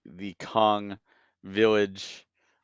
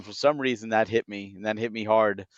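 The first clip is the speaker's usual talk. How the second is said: ordinary delivery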